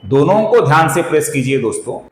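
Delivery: usual speaking voice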